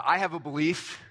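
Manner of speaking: "belief" set apart with deliberate emphasis; stress on belief. Real, not acted. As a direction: lean on the word "belief". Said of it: "I'm emphasizing the word belief".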